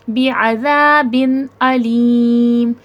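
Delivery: بعذاب very slow, the n of the tanween said clearly without nasal hum; slow tajweed reading of بعذاب with izhar; izhar recited. Shown as a demonstration. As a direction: recite بعذاب علیم slowly with a clean, unnasalised n. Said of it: "اظہار کا قاعدہ لگے گا".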